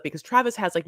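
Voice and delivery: ordinary delivery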